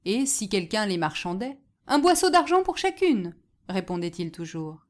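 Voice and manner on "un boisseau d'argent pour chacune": high, loud announcement; raised seller's call; calling out a price. A narrator's reading. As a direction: proclaim the price to the crowd. Hawker repeats his price.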